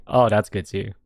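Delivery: ordinary talk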